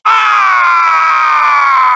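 loud, drawn-out falling scream; long scream. Acted. Pilot shot down in battle, long loud scream. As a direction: scream as if going down.

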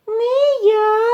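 very high, loud mouse squeak; mouse calling out refusal. A narrator's reading. Mouse Vert refuses to carry grain, high call. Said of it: "гукає верть".

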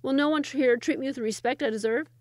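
indignant demand. Acted. The Sultan demands respect from peasants, loud and haughty.

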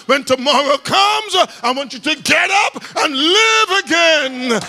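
rising, shouted preacher's cry; preaching at full force. Acted. Preacher voicing God's promise to the congregation, building to a shout.

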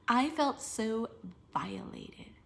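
breathless whispered reread. Shown as a demonstration. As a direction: whisper it under your breath. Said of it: "rereading it whispered".

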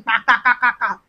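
spoken "ka ka ka" mock cackle; imitated laughing. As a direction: imitate laughter in staccato syllables. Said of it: "Then I said laughing".

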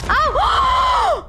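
high shouted gasp of surprise; startled high yelp; sudden high shout; real shock. Real, not acted.

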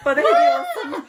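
high, laughing line. Real, not acted. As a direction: laugh while saying it. Laughs while joking.

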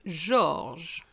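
George as French 'zhorzh'; French pronunciation. Shown as a demonstration. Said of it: "but in French is".

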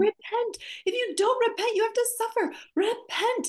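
high, pleading repent; imitating a pleading Jesus. Jesus pleading with his people to repent. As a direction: beg them to repent, voice up high. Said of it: "it sounds like he's mad".

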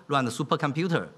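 normal talking voice

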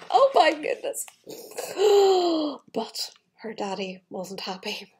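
plain reading voice

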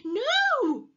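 high, loud, drawn-out cry; terrified refusal. A narrator's reading. Peter refusing Mum's hug, terrified. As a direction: recoil in panic.

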